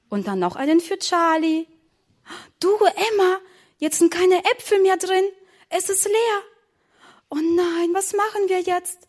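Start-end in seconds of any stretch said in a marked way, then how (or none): 2.6-6.4 dismayed discovery. Acted. Discovering the strudel is empty. 7.3-9.0 put-on high startled cry of a scared girl; girl realising they emptied the strudel; frightened. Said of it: "fragte Emma erschrocken".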